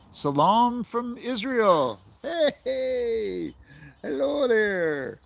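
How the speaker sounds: cheery greeting. Real, not acted. Greeting a viewer on live stream.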